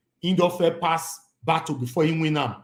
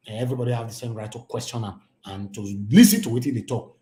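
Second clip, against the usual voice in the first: ordinary delivery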